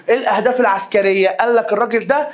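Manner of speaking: ordinary delivery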